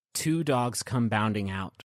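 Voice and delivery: ordinary talk, nothing marked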